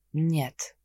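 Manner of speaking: тихо и ниже, сухо; отрезать коротко и холодно. Acted. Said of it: "сухо ответил Генрих". (сухой отказ, тише)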